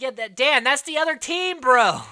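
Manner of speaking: loud and high, calling across the challenge. Retold or imitated. Teammate shouting at Dan about the other team, loud and high.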